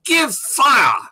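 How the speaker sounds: high, loud barked military command; officer's shout. Retold or imitated. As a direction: bellow it like an English officer ordering the cannons. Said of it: "English officer shouting".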